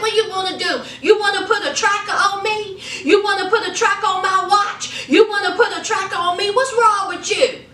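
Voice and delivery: high, exasperated pleading; defensive husband. Retold or imitated. Husband pushing back at his wife, high and exasperated. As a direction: throw the questions back at her. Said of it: "He was like".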